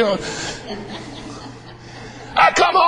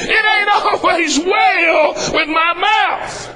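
high, slow shouted refrain, each word stretched; slow preaching refrain; 6 semitones higher, much slower than usual. Acted. Preacher to his congregation, slow shouted refrain. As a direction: call it out slowly to the congregation.

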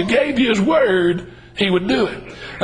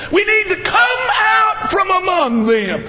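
higher, slower, ringing preacher cry; preacher crying out to his church, higher and slow; preaching at full force; 8 semitones higher, slower than usual. Real, not acted. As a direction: lift the congregation with it. Preaching climax, loud and high.

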